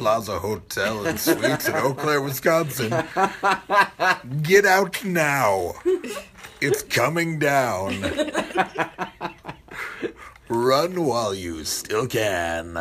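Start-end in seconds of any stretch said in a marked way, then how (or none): 1.8-12.8 loud, slow, hammy villain warning; dubbed anime villain. Acted. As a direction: boom it out like a cartoon villain. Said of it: "You sound like a dubbed anime villain".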